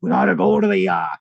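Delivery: lightly put-on Chicago accent; mock Chicago accent. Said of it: "Venom as a Chicago".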